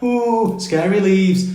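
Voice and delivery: high sarcastic ooh, pretending to be scared; mock the excuse with fake fright; a hammed-up spooked tone; sarcastic mock fear. Mocking leaves-on-track excuse.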